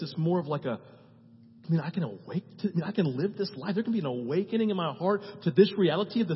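ordinary talk, nothing marked

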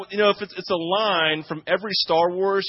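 ordinary delivery

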